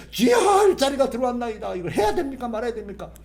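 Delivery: high, loud cry to the Lord; loud, high pleading prayer; fervent crying-out prayer. Shown as a demonstration. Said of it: "이걸 가지고".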